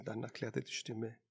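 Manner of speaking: plain reading voice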